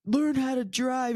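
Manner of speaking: mimicked road-rage telling-off; retold angry outburst. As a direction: play the ranting driver, high and slow. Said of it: "started going off on me".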